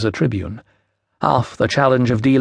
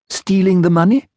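higher, questioning echo with rising end; startled question; 7 semitones higher, 3 dB louder than usual. A narrator's reading. Official queries the woman's talk of stealing, surprised.

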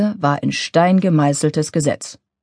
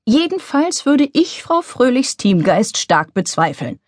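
higher, stern office grouch; 6 semitones higher than usual. A narrator's reading. Karl Wenninger doubts Luisa's team spirit, sternly. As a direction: severe and disapproving. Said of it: "sagte Karl Wenninger streng".

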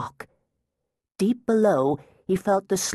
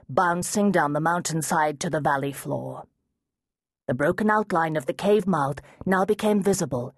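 ordinary delivery

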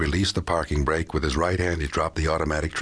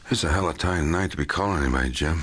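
ordinary delivery, nothing marked

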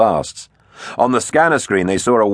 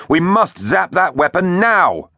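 high, drawn-out urgent command; shouted order; 11 semitones higher, slower than usual. A narrator's reading. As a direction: call it out slowly and high to rally the crew. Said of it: "Tegs shouted".

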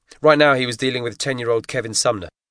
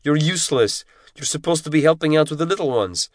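higher, tight scolding instructor's voice; giant Russian instructor, scolding; 8 semitones higher than usual. A narrator's reading. Instructor scolds ten-year-old Kevin over the spork, harsh scolding. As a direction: tear into the boy like a drill instructor.

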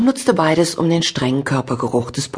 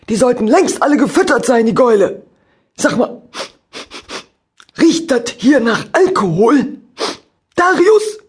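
angry shouting; 9 semitones higher, 5 dB louder than usual. Acted. Angry farmer scolds, smells alcohol.

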